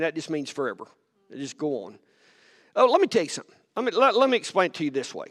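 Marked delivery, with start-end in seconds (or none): none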